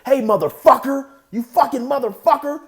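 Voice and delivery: loud, high-pitched furious challenge; acted angry yelling; angry confrontation. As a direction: shout it like a jealous man squaring up. Acted yelling during the slap.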